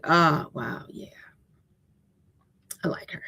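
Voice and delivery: hushed, breathy wonder; awed whisper. Pretend. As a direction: whisper it as if spellbound. Listener reacting to hearing Angelou.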